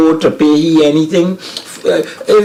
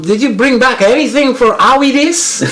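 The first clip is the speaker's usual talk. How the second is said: mimicking a returning local with a high American twang; high, put-on Yankee accent to mock the returnees; 12 semitones higher than usual. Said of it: "They come back and they're like".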